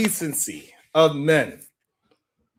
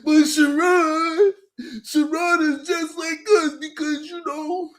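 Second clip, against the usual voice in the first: mocking whiny men; 8 semitones higher than usual. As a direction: whine like a complaining man. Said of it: "The men be complaining that".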